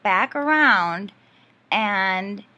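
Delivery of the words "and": ordinary talk, nothing marked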